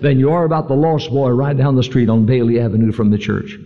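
earnest sermon cadence; preaching. Acted. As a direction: preach it to the congregation. Sermon delivery.

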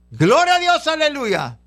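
alabanza gritada con voz muy aguda; grito de alabanza. Shown as a demonstration. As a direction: grita como quien proclama victoria. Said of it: "cuando usted grita". (grito agudo de júbilo)

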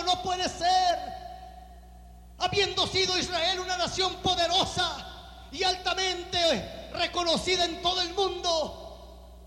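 Nehemiah cries out slow and loud, stricken; slow, loud cry of disbelief; Nehemiah's lament. Acted. Preacher voicing Nehemiah hearing of his people, slow anguished cry.

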